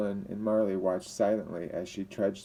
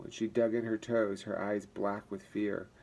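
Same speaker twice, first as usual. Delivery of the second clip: ordinary talk, nothing marked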